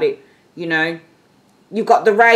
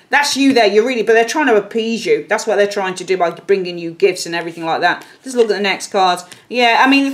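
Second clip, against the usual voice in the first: ordinary talk, nothing marked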